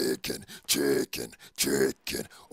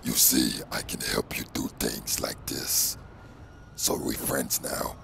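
whispered symbiote voice; breathy whispered monster; Venom whisper; 11 dB louder than usual. Acted. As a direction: rasp it out as the creature in his head.

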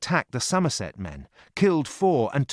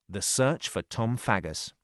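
ordinary delivery, nothing marked

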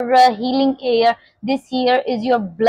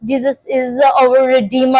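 normal talking voice

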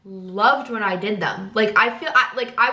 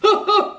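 high burst of laughter; 10 semitones higher, 3 dB louder than usual. Real, not acted. Laughing at herself.

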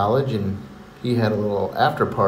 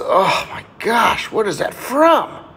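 high, drawn-out mock disgust; appalled reaction; 10 semitones higher, 4 dB louder, much slower than usual. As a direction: gasp it out slowly, stressing each word. Acted reaction to a smell.

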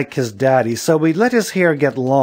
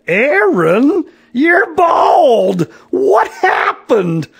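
loud, high shriek of alarm; shocked cry; 7 dB louder, much slower than usual. A narrator's reading. Mother seeing her suddenly bald son, shocked shrieking.

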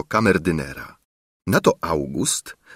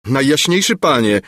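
higher, alarmed courtly appeal; urgent exclamation; 6 semitones higher, 4 dB louder than usual. A narrator's reading. Minister warning the king at breakfast, urgent. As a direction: cry out to the monarch. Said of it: "wykrzyknął minister".

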